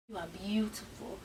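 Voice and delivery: very low, hushed seduction; low, soft mock-romantic voice; smooth suitor. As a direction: imitate a suave man murmuring compliments. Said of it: "y'all should have heard him".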